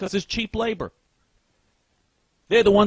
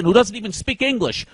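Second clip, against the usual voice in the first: ordinary talk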